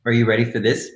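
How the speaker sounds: normal talking voice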